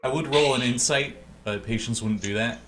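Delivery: ordinary talk, nothing marked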